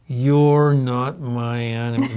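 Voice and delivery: slow, drawn-out mock-ominous delivery; hammed-up and heavily drawn out; exaggerated forced declaration. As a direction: overdo it, stretching every word. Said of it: "No, it wasn't quite that bad".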